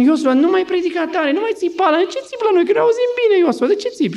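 very high, whining objection; high-pitched, fed-up pleading; exasperated complaint. Acted. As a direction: act out people begging the preacher to stop shouting. People complain that Joshua shouts.

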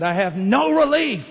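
loud, high anguished plea; desperate cry. Retold or imitated. Woman pleading with the preacher, loud despair.

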